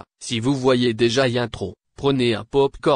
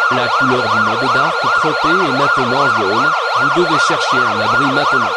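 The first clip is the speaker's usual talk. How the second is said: much higher, louder flat monotone alert; robotic siren alert; 6 dB louder than usual. Acted. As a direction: drone it out like an alarm siren. Emergency alert continues. (voix d'alerte robotique, aiguë et forte)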